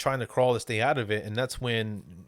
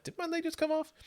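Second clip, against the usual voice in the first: much higher, airy offhand imitation; mock nonchalant; 4 dB quieter than usual. Podcaster mimicking the man who lost his leg, nonchalant. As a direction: ask it high and unbothered, as an impression. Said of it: "Just all nonchalant, like".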